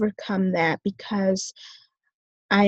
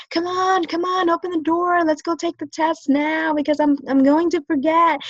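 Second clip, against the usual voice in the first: high-pitched, urgent plea; rushing someone along; 7 semitones higher than usual. Retold or imitated. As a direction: hurry them up insistently, pitch raised. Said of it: "I would rush my professor".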